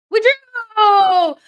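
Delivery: angry shout. Real, not acted. Yelling at the match.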